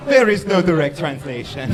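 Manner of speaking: put-on loud, wobbly Portuguese-Jamaican accent; botched Portuguese accent that comes out Jamaican; Portuguese accent slipping into Jamaican. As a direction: declare it in a muddled Jamaican-sounding accent. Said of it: "That was Jamaican for a second".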